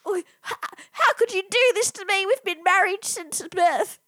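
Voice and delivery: high, hurt mock wailing; betrayed spouse. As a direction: play the wronged wife.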